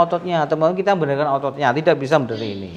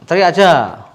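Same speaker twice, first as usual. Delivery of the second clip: pushed out louder, urging him to shout; 4 semitones higher, 7 dB louder than usual. Shown as a demonstration. Therapist urges patient louder to just shout. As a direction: call it out louder as encouragement.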